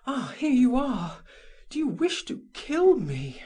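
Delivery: anguished high cry of a jilted lover; Madame Walter crying out. A narrator's reading. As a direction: cry out at him, wounded. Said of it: "She cried".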